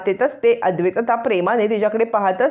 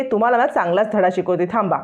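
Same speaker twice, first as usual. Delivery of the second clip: usual speaking voice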